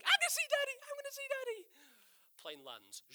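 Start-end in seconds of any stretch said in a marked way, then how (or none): 0.0-1.7 put-on high squeal of a little girl; bouncy child voice, much higher; excited little girl. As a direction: squeal it like an excited seven-year-old. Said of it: "she's going".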